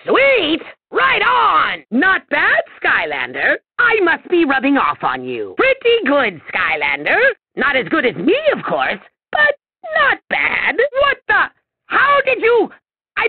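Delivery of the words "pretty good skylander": usual speaking voice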